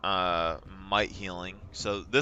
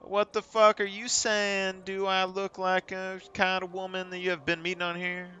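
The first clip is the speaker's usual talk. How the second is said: hammed-up outraged stranger, higher voice; indignant woman; 9 semitones higher than usual. Angry online stranger snapping back, much higher.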